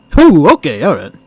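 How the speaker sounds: normal talking voice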